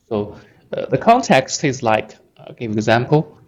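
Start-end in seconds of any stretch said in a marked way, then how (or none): none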